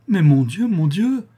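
a sharp, high cry of pained impatience. A narrator's reading. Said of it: "avec une impatience douloureuse".

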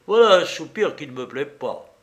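stern telling-off with a touch of irritation; irritated mentor. A narrator's reading. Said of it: "d'un ton de menteur irrité".

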